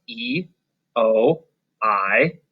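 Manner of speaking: "E, O, I" said as letter names, spaced apart; long vowel sounds. Shown as a demonstration. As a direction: list "E, O, I" as long ee, oh, eye. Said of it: "their long vowel sounds".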